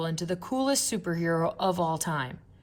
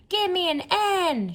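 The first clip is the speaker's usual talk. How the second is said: cheerleader shout; 13 semitones higher, 5 dB louder than usual. A narrator's reading. Cheerleaders rousing the crowd, loud high chant. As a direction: belt it out like a cheerleader on the field. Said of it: "shouted the cheerleaders".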